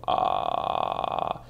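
low whispery creaky fry; crackling creak on ah; vocal fry. Shown as a demonstration. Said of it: "o sea, esto".

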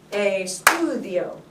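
ESTUDIO slow, STU held long; stress on STU. Shown as a demonstration. Teacher repeating the accented word, STU drawn out in ESTUDIO. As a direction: stretch and stress STU in "Estudio", falling after it. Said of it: "The stu".

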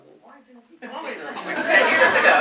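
high exasperated question bursting into laughter; playfully complain, breaking up loudly at the end; laughing through a retold grievance; laughing mock complaint.

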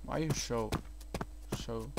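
ordinary talk, nothing marked

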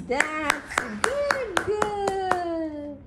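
very drawn-out, high sing-song praise. Real, not acted. Mother praising her baby girl, high baby talk.

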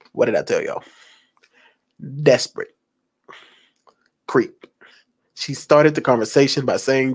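usual speaking voice

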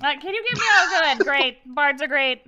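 a lightly voiced crone overdoing her delight.

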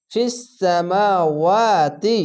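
ফিস সামাওয়াতি very slow, vowels stretched one alif; elongated recitation. Shown as a demonstration. Teacher showing vowel length, very slow chant. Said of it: "খাড়া জবরে এক আলিফ টেনে পড়বেন".

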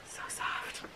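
very soft, voiceless whisper; awed whisper. Real, not acted. Vlogger feeling her new top, awed whisper. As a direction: whisper it, savoring the softness.

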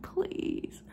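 soft sassy whisper. Real, not acted. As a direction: breathe it out unvoiced.

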